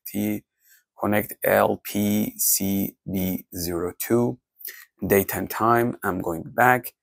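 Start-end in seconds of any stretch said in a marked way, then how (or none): none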